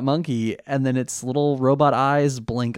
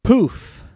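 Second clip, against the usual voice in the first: loud, high mock "poof" of vanishing; poof sound effect; 9 semitones higher, 6 dB louder than usual. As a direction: pop it out like a cartoon puff. Voicing a character vanishing.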